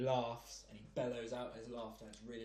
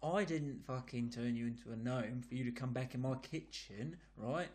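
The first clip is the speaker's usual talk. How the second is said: put-on London geezer accent; 2 semitones higher than usual. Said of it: "in a London accent, like a proper geezer".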